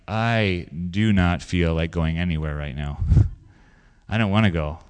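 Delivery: low, slow, subdued grumble; retold queasy reluctance; sick and reluctant. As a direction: drag it out quietly, as if too ill to move. Retelling feeling sick, reluctant to go.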